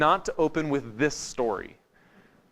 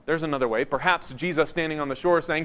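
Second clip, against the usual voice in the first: ordinary talk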